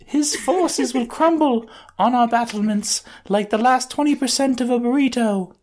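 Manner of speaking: put-on very high, timid anime girl voice; shy anime girl. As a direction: squeak it like a bashful anime girl. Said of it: "crept forward ... shy girls do in anime".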